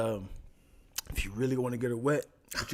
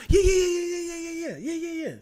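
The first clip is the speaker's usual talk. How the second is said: frantic agreeing; 4 dB louder than usual. Retold or imitated.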